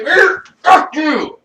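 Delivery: slow, high, loud fuck you; hammed-up mirror shout; yell at the mirror. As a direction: spit it at your own reflection. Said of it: "and you gotta say".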